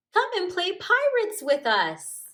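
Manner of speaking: loud, high eager call. Acted. Hare invites friends to play pirates with a loud high call. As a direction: keep hailing them across the river.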